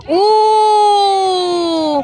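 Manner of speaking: loud, high shout to the court; excited cheer. Real, not acted. Spectator urging a player on, loud high cheer.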